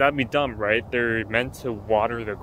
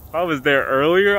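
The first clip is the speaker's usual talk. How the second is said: normal talking voice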